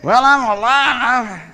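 very slow, loud, hammed-up reply; mock half-hearted "alive". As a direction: stretch it out in mock resignation. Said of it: "Is that what alive looks like".

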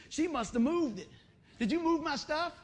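hammed-up exasperated demand; mock accusation. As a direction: act the husband accusing his wife. Acts out blaming wife for badge.

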